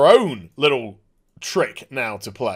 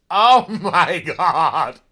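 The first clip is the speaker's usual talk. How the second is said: long, drawn-out oh my god; excited laughing; 5 dB louder, much slower than usual. Real, not acted. Fan reacting to death troopers, excited laughing. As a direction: stretch it out, loud, with a laugh.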